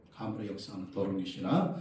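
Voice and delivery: low, hushed rebuke; soft, low voice kept to yourself; inward rebuke. Shown as a demonstration. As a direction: say it under your breath, as if inwardly. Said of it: "속으로".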